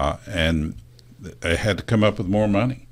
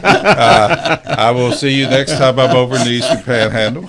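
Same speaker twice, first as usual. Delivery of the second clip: laughing goodbye; 8 semitones higher, 14 dB louder than usual. Real, not acted. Signing off laughing loudly.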